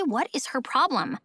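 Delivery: usual speaking voice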